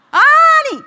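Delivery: very high and loud indignant cry; furious cry. Retold or imitated. Pastor recalling her rage at the couple, furious outcry. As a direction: burst out high and loud in outrage. Furious outburst retelling anger.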